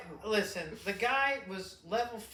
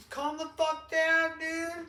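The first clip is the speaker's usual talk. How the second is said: high, slow exasperated bro; put-on high bro voice, slowed down; mock bro voice; 10 semitones higher, 6 dB louder, slower than usual. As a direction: drawl it out like a fed-up dude. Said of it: "You'd be like that guy".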